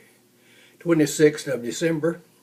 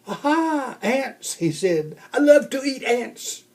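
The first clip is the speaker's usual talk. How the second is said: delighted; 9 semitones higher than usual. Acted. Bear finds ants, delighted.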